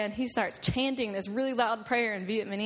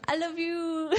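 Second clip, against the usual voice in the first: imitating Banjo; 8 semitones higher, slower than usual. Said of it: "grabbing onto us and going".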